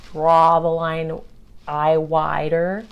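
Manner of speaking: ordinary talk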